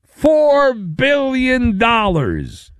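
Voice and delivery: slow and high, punching "four"; dramatic slow reveal of the price tag; big number reveal. Acted. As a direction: lean hard on "four billion" for effect. Host repeats the huge number.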